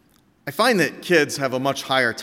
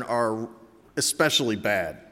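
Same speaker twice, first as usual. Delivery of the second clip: ordinary delivery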